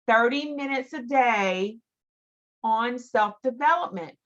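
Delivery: normal talking voice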